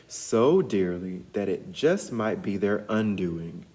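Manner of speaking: usual speaking voice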